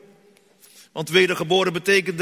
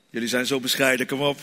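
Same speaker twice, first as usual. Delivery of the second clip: usual speaking voice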